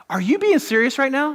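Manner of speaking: incredulous wife. Retold or imitated. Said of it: "my wife's like".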